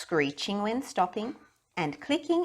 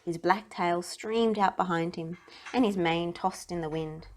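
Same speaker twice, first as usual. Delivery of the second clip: plain reading voice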